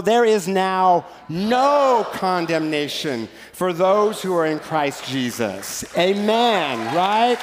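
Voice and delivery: slow, emphatic, stress on no; Pentecostal preacher. Shown as a demonstration. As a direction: proclaim it like the most amazing news ever. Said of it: "Read it like a Pentecostal preacher".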